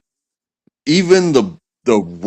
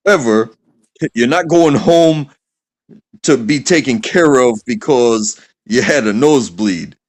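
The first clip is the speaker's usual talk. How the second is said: higher-pitched dad laying down the law; stern lecturing dad; 5 semitones higher than usual. Acted. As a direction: scold a kid in a no-excuses dad voice. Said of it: "you seem like you're this type of dad".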